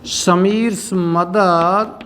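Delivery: very slow, word by word with long pauses; drawn-out words, long gaps between phrases; dictation. Shown as a demonstration. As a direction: dictate it slowly for students to copy. Teacher dictates answer for writing.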